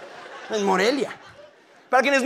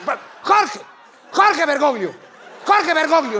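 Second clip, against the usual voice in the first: desperate calling out; 8 semitones higher, slower than usual. Pretend. Fan shouting apology to the Pope.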